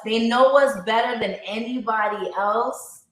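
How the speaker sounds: ordinary talk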